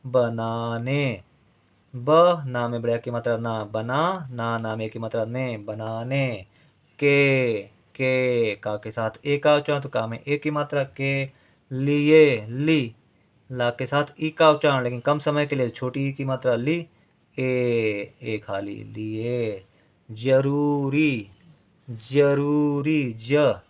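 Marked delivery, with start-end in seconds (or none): 0.1-2.7 बनाने drawn out slowly, syllable by syllable; teacher spelling out बनाने slowly for a pupil. Shown as a demonstration. Teacher to pupil, slow dictation of बनाने. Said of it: "तो ना में ए की मात्रा". 20.1-21.3 जरूरी stretched out slowly, each syllable apart. Shown as a demonstration. Teacher to pupil, slow dictation of जरूरी. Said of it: "रा के साथ ऊ का उच्चारण".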